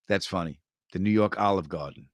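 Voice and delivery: normal talking voice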